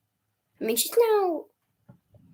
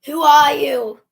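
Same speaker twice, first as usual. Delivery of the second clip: mock challenge; 4 semitones higher, 12 dB louder than usual. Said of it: "And then they go".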